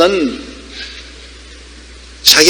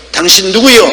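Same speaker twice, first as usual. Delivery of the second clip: higher-pitched, gruffly curt question; acted brusque challenge; 5 semitones higher, 3 dB louder than usual. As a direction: challenge him brusquely, as if playing a park keeper. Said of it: "퉁명스럽게 물었습니다". (퉁명스럽게 묻는 관리인 흉내)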